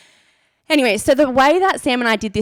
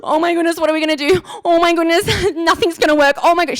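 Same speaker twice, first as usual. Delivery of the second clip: imitating a panicked friend, gasping and higher; mock hyperventilating; 6 semitones higher, faster than usual. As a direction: gasp between phrases in a hammed-up panic. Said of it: "this is the point where she starts hyperventilating".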